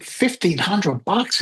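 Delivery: drawn-out awe at the money; stunned disbelief. Retold or imitated. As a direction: let the sum sink in slowly, amazed.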